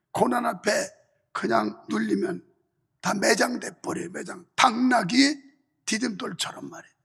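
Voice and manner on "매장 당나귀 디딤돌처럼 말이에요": usual speaking voice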